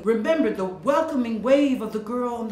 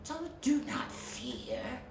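slow, hushed snake voice; sly insisting snake; 2 semitones higher, 9 dB quieter, slower than usual. Acted. Sea snake insisting Melody need not fear, sly hush. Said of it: "the sly creature insisted".

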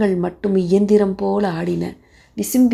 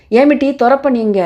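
sharp demand; 5 semitones higher, 6 dB louder than usual. Acted. Husband shouting at wife.

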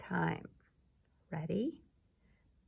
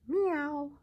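high "Meow" like a cat; 8 semitones higher than usual. Shown as a demonstration. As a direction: mew it like a little cat. Sound ladder drill.

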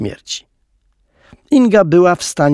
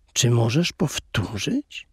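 much softer, slow hushed question; soft slow whisper at a sick bed; gentle whisper; 8 dB quieter, slower than usual. A narrator's reading. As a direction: hush it as at a sickbed. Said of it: "odezwał łagodnym szeptem".

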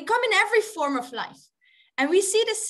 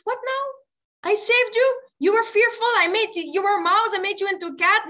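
raised, louder scolding; exasperated yogi; 5 semitones higher, 5 dB louder, faster than usual. Acted. Yogi to the cat back for help, exasperated. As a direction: reproach someone you already rescued.

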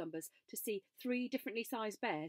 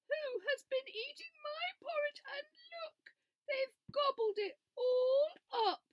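very high, tearful little bear voice; a tiny cub close to crying; high squeaky cub on the verge of tears; Small Bear; 6 dB louder than usual. A narrator's reading.

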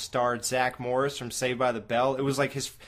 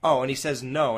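ordinary talk

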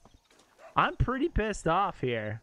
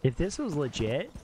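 usual speaking voice

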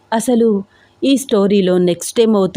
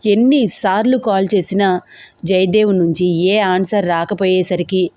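plain reading voice

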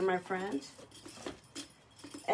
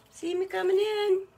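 the line again but much higher, sing-song; playful high repeat; 10 semitones higher than usual. Acted. Host showing off the bracelet to viewers, high sing-song. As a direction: toss it up high like a proud little tune.

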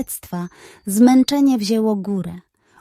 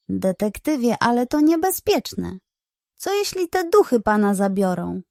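ordinary delivery

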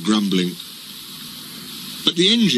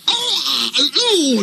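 high, piercing cries of the trucks; trucks screaming; 13 semitones higher, much slower than usual. A narrator's reading. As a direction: scream as you are jerked forward. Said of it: "they screamed".

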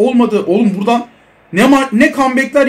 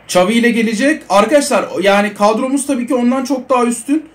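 ordinary delivery

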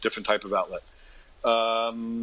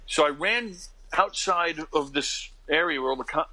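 usual speaking voice

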